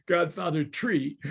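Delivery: "Godfather Tree", th said as t; slow "Godfather Tree" with a hard t; accented "Tree". Acted. Teller relishing the mafioso's accent, "Tree" with hard t. Repeats mafioso's pronunciation, amused.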